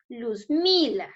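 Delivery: "Luzmila" slow, with mi held and strong; stress on MI. Shown as a demonstration. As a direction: say "Luzmila" slowly as luz-MI-la. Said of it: "Ahí está la mayor fuerza de voz".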